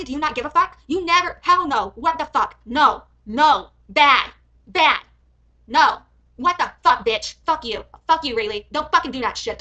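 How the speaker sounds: loud, indignant outburst; real anger, punching each short word; angry scolding. Real, not acted. Reviewer outraged at a threat to call police, angry scolding. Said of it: "I got mad".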